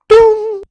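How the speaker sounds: high, loud sung-out boom; sudden high "boom" sound effect; imitated boom. Announcing the flight attendant's arrival, high imitated boom.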